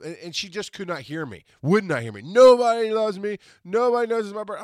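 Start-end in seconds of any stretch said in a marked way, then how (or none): 2.2-4.6 loud, high mock wail; hammed-up self-pitying wail; mock crying. Friend too upset to hear caller, mock wailing. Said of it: "she answered her phone crying".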